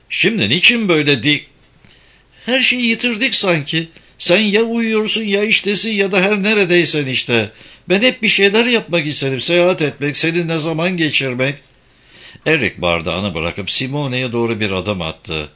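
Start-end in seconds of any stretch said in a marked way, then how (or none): 0.1-11.5 higher-pitched, reproachful complaint; mild reproach. A narrator's reading. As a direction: let the pent-up complaint come out, pitched up. Simone reproaches Eric in argument. (sitemli, tiz bir sesle)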